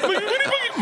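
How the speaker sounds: very high squealed disbelief; mock starstruck shock. Dad recognizes the celebrity who comforted him, squealing mock shock. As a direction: squeal it in hammed-up astonishment.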